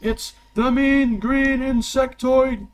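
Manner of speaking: high, slow, loud fight intro; arena announcer. Acted. Game master introducing a fighter to the arena, booming announcer. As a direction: draw the name out for the crowd. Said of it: "this announcement, by the way".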